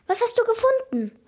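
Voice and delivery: eagerly wanting to know; curious. A narrator's reading. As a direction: ask with keen curiosity. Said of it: "Noah kam neugierig zu mir".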